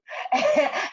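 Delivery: high, loud burst of laughter; bright laugh. Real, not acted. Guest laughing after warning not to make her angry, loud high laugh. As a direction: laugh at your own joke.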